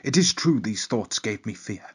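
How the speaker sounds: lightly posh storyteller voice. Acted. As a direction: read it in a hint of posh. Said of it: "it's even worse the second time".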